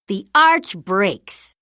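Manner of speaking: usual speaking voice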